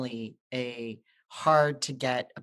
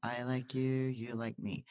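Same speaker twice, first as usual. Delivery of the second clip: softer, repeated under her breath behind a mask; muttered mantra; 8 dB quieter than usual. Retold or imitated. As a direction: murmur it to yourself like a calming chant. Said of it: "So I'm behind my mask again".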